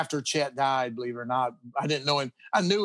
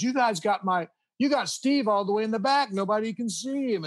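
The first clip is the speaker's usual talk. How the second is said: higher, mock-fussing mom; a bit higher mother's complaint; mom complaining; 6 semitones higher than usual. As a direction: imitate a mom fussing about her son. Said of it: "she goes".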